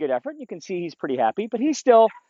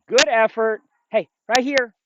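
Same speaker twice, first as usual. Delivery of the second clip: upbeat praise; 6 semitones higher, 4 dB louder than usual. Real, not acted. Praising and calling dog.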